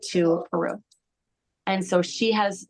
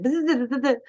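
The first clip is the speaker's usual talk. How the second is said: buzzing; 6 semitones higher than usual. Retold or imitated. Healer moving energy with buzzing.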